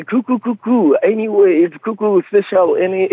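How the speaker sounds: high, chanted mock-American English; sing-song fake American accent with a laugh; attempted American accent. Shown as a demonstration. Guest tries the caller's request, hammed-up American accent. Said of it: "le faire avec l'accent américain".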